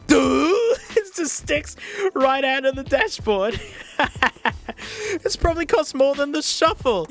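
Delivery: high, teary laughing through the words; helpless laughter. Real, not acted. At a cable sticking out of the dashboard, laughing high and teary. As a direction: crack up almost to tears.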